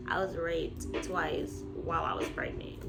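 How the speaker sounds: normal talking voice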